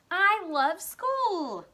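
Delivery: high, drawn-out enthusiastic cheer; excited exclamation. Shown as a demonstration. Teacher suggesting a school sentence to pupils, excited. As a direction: exclaim it slowly with big pitch swoops. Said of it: "that would need exclamation marks".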